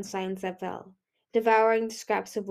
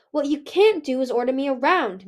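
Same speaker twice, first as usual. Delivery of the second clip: sharp, raised rebuke; 4 semitones higher, 6 dB louder than usual. A narrator's reading. Moon refuses to take orders from Winter, sharply. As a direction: push back firmly, pitch up. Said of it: "Moon said sharply".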